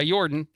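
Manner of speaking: "Jordan" said as "Yordan"; Y-sound pronunciation. Shown as a demonstration. Host retelling the couple's arrest, "Jordan" said "Yordan". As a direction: swap the J in "Jordan" for a Y. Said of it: "I wanna keep saying it that way".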